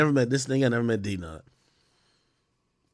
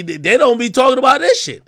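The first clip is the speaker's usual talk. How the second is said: rising rant; 8 semitones higher, 9 dB louder than usual. Real, not acted. Ranting about other rappers.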